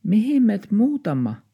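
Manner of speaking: very high, small dog's voice; little dog voice. A narrator's reading. Little dog asks its owner where they move, very high. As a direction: ask like a tiny puppy. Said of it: "taksi kysyi".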